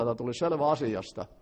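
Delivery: ordinary talk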